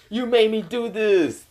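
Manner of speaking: high, drawn-out mock accusation; accusing whine. Naruto blaming Kurama, high accusing protest. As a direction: blame them, pitched high and slow.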